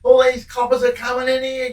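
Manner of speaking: high, loud bellowing call; yelling out. Retold or imitated. Prisoner calling down to the union meeting, high loud yell. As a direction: shout it across the yard to your mates. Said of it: "he yells out".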